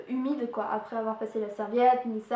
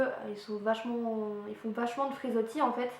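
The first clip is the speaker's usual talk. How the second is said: ordinary talk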